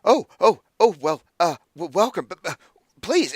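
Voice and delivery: flustered high stammer, loud bursts; startled, stumbling over the welcome; very surprised. Acted. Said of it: "he's s-, very surprised to see anyone".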